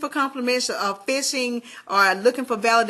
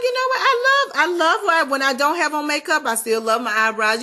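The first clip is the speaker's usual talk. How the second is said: much higher, bright burst of joy; excited self-love; 5 semitones higher, 3 dB louder than usual. Retold or imitated. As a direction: announce it like a sudden happy discovery. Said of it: "I was like".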